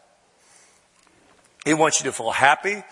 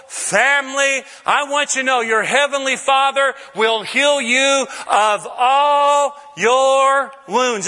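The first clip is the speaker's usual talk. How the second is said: preaching at full force; 9 semitones higher, slower than usual. Acted. Preacher promising the congregation healing, shouting. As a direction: drive it home to the congregation.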